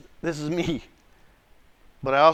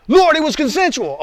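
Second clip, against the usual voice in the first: loud, high-pitched pleading excuse; acted indignant objection; protesting; 10 semitones higher, 5 dB louder than usual. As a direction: argue back at God, high and loud. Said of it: "listen to my responses".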